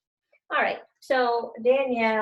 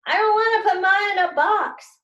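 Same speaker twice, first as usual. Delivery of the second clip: high, loud wailing cry; crying protest; 10 semitones higher, 7 dB louder than usual. Acted. Anna cries to the teacher about the box, high and loud. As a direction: wail it like a child in a meltdown. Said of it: "she cried".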